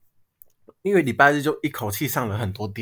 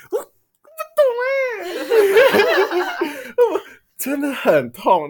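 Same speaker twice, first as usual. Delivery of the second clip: whiny complaint; 6 dB louder than usual. Real, not acted. Complaining about being made to work.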